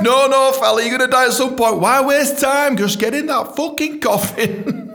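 high, loud, hammed-up overruling; escalating acted rant, slowing at the end; mock impatient insistence. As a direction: shout the victim down, comically exasperated. Acted argument, overruling the victim.

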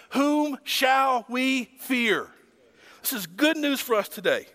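normal talking voice

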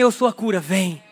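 Eu sou a cura, vem — quiet, low and gentle; tender invitation. Retold or imitated. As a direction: softly call her in. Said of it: "Deus tá dizendo assim".

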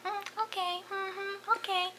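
put-on high, soft little baby voice; cutesy babyish mm okay. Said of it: "a little baby voice".

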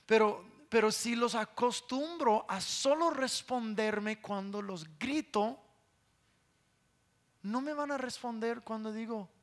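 usual speaking voice

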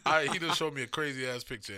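talking through hiccups. Real, not acted. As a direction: hiccup breaks the words. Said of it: "I done fucking got the hiccups now".

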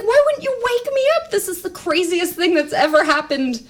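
high-pitched, indignant mock wail at mom; retold indignant outrage.

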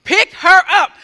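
loud, high, emphatic order; forceful command. Retold or imitated. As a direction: punch out each word as an order. Healer repeats order more forcefully.

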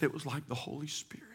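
reverent whisper. Acted. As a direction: hush it like a holy secret. Reverent quote of Corrie.

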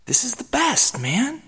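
heartfelt, high-pitched excitement with friends; rising excitement. Acted. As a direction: gush about the perfect summer. Emotional speech to friends.

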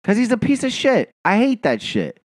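real high-pitched, agitated rant; worked up. Real, not acted. Fan ranting to friend about the coach, worked up. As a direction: argue it hotly, pitched well up. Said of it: "You get so worked up".

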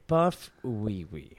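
slow imitation of a reluctant reading; mocking unconfident delivery. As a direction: drag "Buff Wee Wee" out mockingly. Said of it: "and then say mine. Super, like".

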